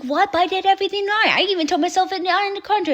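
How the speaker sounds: high, fast mock disbelief; disbelieving Chen. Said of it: "He's like".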